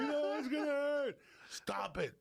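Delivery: high-pitched imitation of a frightened kid; protesting child. Small boy begging off a shot, mock high protest. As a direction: plead like a kid dodging a needle.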